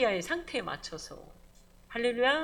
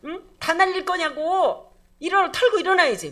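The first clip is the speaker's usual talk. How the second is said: scolding counsellor barks at the fallen prophet; harsh rebuke; 7 semitones higher, 10 dB louder than usual. Acted. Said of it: "이렇게 말한단 말이야".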